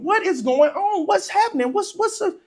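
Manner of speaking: put-on high-pitched pestering kid; insistent questioning. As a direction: keep pressing in a child's high voice. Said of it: "And I keep asking".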